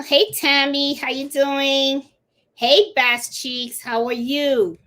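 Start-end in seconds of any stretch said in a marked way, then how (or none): none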